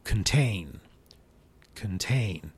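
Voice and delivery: "contain" with weak kən, stressed tain; "contain" quick weak first syllable, strong second; schwa contain. Shown as a demonstration. Teacher models contain with a schwa. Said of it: "as in".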